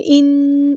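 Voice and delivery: ان held in the nose; ان, doubled noon hummed through the nose; ghunna. Shown as a demonstration. Teacher models ghunna for students, nasal hum. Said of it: "اس طرح ناک میں آواز روکیں گے".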